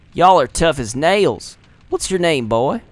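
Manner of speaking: lightly drawled Southern voice, slow on "Y'all"; a tough Southern fighter sizing up a boy; Southern accent. Acted. Gamer voicing a Southern fighter in a Southern accent. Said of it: "switch between a southern and whatever accent".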